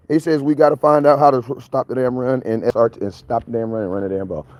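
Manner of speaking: ordinary delivery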